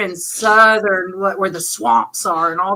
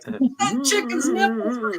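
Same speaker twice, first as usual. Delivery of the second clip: mock dreaming mumble; 2 semitones higher, 6 dB quieter, slower than usual. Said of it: "I'm gonna be like".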